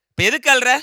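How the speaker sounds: sharp, loud, high retort at the crying wife; husband loudly demanding why she cries; scolding husband. Retold or imitated. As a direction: snap the question out loud.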